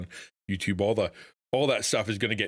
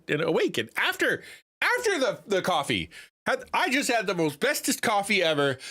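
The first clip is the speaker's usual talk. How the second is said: put-on TikTok accent; 12 semitones higher than usual. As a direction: parody the TikTok accent, pitched way up. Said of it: "I guess the TikTok accent makes sense".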